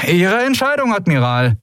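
high, sing-out parting call; pirate calling out. A narrator's reading. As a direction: toss it back high as you're dragged off. Pirate calls out while dragged away.

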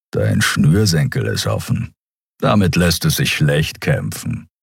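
a hint of a friendly beast; rumbling giant. Skria pointing out Miko's shoelace, low rumble.

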